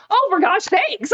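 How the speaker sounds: high, loud, drawn-out exasperation; slow, high-pitched fed-up outburst; exasperated outburst. Retold or imitated. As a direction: cry out in comic exasperation. Exasperated at mother playing cards.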